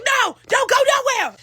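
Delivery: high, loud, slow shouted order; high slow shouted command; mock yelling mother. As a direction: yell it like a furious mom. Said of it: "She like".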